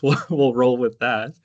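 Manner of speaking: usual speaking voice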